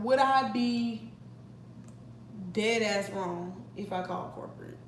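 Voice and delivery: ordinary talk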